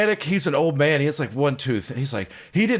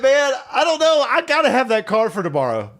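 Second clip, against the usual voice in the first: higher-pitched mild mock plea; retold pleading; 6 semitones higher than usual. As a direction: plead with the mechanic for the car. Retold customer pleading for car.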